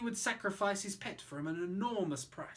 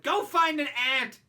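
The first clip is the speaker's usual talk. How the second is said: loud, higher brat retort; snapping boy; 7 semitones higher, 10 dB louder than usual. Acted. Roy brushing off the anteater, loud snap. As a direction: dismiss it sharply. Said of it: "the boy replied".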